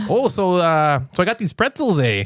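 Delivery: folksy put-on Minnesota accent; hammed-up Minnesota accent. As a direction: chat like a friendly Minnesotan. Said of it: "what about Minnesota".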